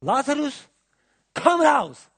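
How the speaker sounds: calling a dead man out of the grave at full voice; high, loud summons. Retold or imitated. Jesus calling Lazarus from the tomb, a high loud shout. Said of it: "Und dann hat er gerufen".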